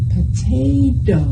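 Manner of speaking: Potato said slowly with a tapped t at the end; slow clear Potato, tongue tapping the last t; over enunciating. Shown as a demonstration. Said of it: "make your tongue tap at the end like mine".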